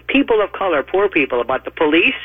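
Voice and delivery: ordinary talk, nothing marked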